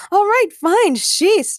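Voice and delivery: high, loud, fed-up outburst; exasperated shout. A narrator's reading. Jane grabbing yet another flyer, shouting. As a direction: snap it loudly, at the end of patience. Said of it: "Jane shouted".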